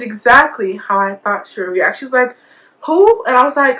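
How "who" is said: high, louder retold "who?"; curious surprise. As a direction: act out her pitched-up question. Retold friend reacts to the news.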